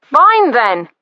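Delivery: loud, huffy fine then; huffy shout. A narrator's reading. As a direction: snap it and give up. Brother giving up, annoyed.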